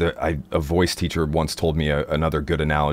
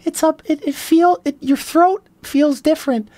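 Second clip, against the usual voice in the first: much higher cartoon-mouse voice; Mickey voice. Shown as a demonstration. Demo of the Mickey Mouse voice to listeners. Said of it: "the Mickey voice".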